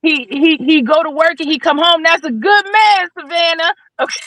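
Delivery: high-pitched, insistent movie-character voice; movie line impression. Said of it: "That's off of a movie".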